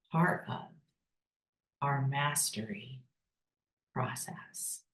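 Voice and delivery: "part of", "our mastery", "process" set apart by long pauses; emphasized words. Shown as a demonstration. Coach underlining a key phrase for business leaders, emphasized piece by piece. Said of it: "I emphasize the words".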